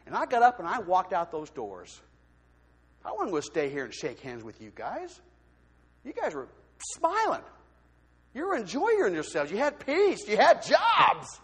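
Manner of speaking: ordinary talk, nothing marked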